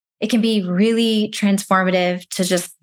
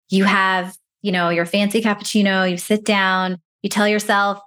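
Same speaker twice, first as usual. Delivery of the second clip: ordinary talk, nothing marked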